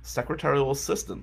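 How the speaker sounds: ordinary talk, nothing marked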